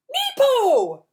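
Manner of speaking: put-on very high, loud baby cry; baby Sonny crying out, very high and loud; baby squeal. A narrator's reading. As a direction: squeal like a toddler.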